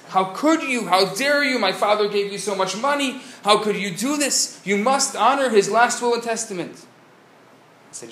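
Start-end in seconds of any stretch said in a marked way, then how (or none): 0.1-6.8 high, loud, indignant protest; upset outburst. Acted. Grieving children confront the burial society, furious and pleading. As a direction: demand it, outraged on their father's behalf. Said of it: "And they were so upset".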